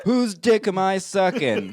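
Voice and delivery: high, loud and drawn-out joke voice; mock swaggering walk-in line. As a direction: belt out a hammed-up entrance line, laughing. Jokey acted line for the walk-in.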